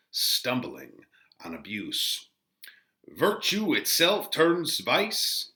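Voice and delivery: slow, swelling Shakespeare reading with loud peaks; dramatic reading. Acted. Teacher reading Friar Lawrence's speech to the class, dramatic and slow. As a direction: declaim the friar's speech with feeling.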